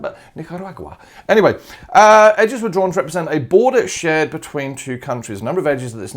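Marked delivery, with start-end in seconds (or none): 0.3-1.0 Nicaragua again with a light Spanish accent, ni-ka-RA-gwa with open "a" sounds; a quiet, playful hint of Spanish on Nicaragua; vaguely Spanish Nicaragua. Shown as a demonstration. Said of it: "I had to sound even vaguely Spanish".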